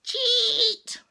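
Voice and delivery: high loud jeering yell; shouted curse. Acted. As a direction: hurl the accusation. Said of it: "You should have heard them cursing".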